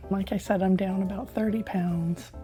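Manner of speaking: usual speaking voice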